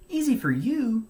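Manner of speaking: high, loud sulky retort. A narrator's reading. Beaver to Bear after falling, high sulky retort. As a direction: snap back at the show-off. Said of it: "said Beaver".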